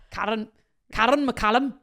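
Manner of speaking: a better try at Scottish, laughing; mock Scottish accent. Retrying the makeup artist's name in a Scottish accent. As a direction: roll the name out in a Scottish lilt. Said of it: "That was better".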